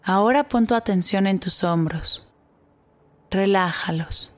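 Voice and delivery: put-on calm guide easing children to relax; guided meditation. Guides a body scan meditation.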